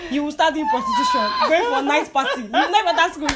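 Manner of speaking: laughing retelling. Retold or imitated. Parents' threats, retold laughing.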